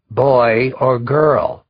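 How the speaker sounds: slow, loud, commanding bark. A narrator's reading. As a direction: demand an answer, no insolence. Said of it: "barked Tut".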